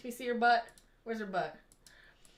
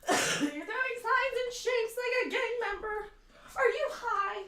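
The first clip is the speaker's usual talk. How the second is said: high-pitched incredulous teasing; high, exaggerated disbelief; mock disbelief; 8 semitones higher, slower than usual. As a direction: scold in a high mock-shocked voice. Teasing co-host about gang signs.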